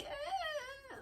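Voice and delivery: high squeaky broken croak; strained croak. Real, not acted. Streamer shows chat how her voice fails with a croak. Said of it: "my voice is so bad today".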